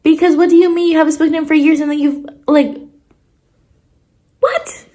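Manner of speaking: high-pitched exasperated disbelief; irritated. Real, not acted. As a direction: scold the characters in sheer disbelief. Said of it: "it really irritates me".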